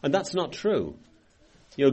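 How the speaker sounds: normal talking voice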